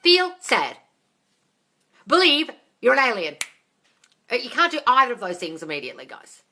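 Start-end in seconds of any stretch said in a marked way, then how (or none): 0.0-0.8 a loud, higher bossy order; mock command. Shown as a demonstration. Host shows how absurd ordering a feeling sounds, loud mock command. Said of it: "you can't force a feeling". 2.1-3.4 a loud, higher bossy order; mock command. Shown as a demonstration. Host shows how absurd ordering a feeling sounds, loud mock command. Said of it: "you can't force a feeling".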